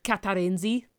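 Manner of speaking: playful put-on Russian accent; mock Russian accent. Joking about a misread name, Russian accent. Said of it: "I thought it was Russian".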